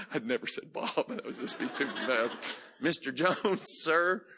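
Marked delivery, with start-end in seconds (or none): 2.8-4.3 high, loud, eager mock address; hammed-up jittery politeness; a young man over-eager to address his elder; nervous formal address.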